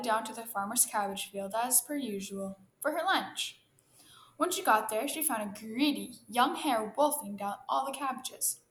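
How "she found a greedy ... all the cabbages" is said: plain reading voice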